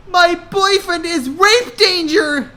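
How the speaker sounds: high loud desperate shout; panicked shout. Acted. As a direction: shout it at the nurse. Hannah shouts at nurse.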